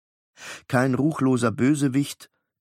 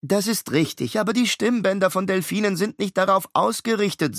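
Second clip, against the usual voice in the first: put-on higher, precise know-it-all boy; clever twin; 8 semitones higher than usual. Miles correcting his brother, higher know-it-all boy. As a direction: lecture your brother, a touch prim.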